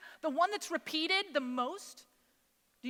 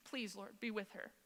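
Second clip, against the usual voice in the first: hushed, low plea; quiet, broken-off begging; desperate prayer; 3 semitones lower, 13 dB quieter than usual. Retold or imitated. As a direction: sink to a soft, spent plea to God. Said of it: "a desperate prayer".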